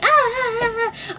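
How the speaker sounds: high-pitched wailing "ah"; re-enacted fangirl scream; excited fan scream. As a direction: squeal like a crazed concert fan. Acting out going crazy jumping.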